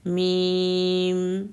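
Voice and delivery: میم with lips pressed, long hummed م; lip letter demo. Shown as a demonstration. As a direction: say میم slowly, lips closing fully on م. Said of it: "اس میں ہے سب سے پہلے میم". (میم کو آہستہ، دونوں ہونٹ ملا کر)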